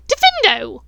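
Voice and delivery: loud shouted incantation; yelled spell. Acted. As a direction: fling the curse out. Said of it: "pointed her wand at his arm and yelled".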